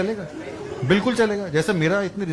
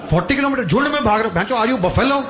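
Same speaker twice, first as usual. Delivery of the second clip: incredulous rant; 5 semitones higher than usual. Real, not acted. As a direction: rant at the runners in disbelief. Comic ranting about marathon runners.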